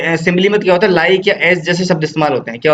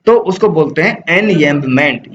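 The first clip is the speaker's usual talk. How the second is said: ordinary delivery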